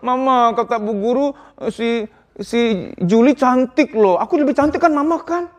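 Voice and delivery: put-on high, slow, drawn-out child voice; child tattling. Child home from school complaining to mama, whiny. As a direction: plead like a little kid wanting reassurance. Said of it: "anak yang pulang-pulang".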